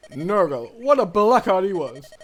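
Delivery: angry outburst. Acted. Hector recalls his old enemy with loud contempt. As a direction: curse the old villain with force.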